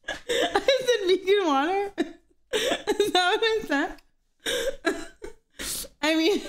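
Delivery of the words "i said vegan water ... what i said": laughing disbelief. Real, not acted. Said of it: "Did I say vegan water?".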